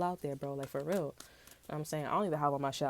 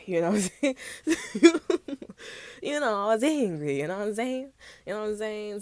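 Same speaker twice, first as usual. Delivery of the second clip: louder, higher, laughing through the words; 6 semitones higher, 10 dB louder than usual. Real, not acted. Telling viewers she was angry, laughing out loud. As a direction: crack up while admitting it.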